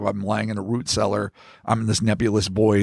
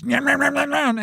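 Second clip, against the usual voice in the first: goblin chomping noise; 13 semitones higher than usual. Acted. Goblin chomping in retold scene.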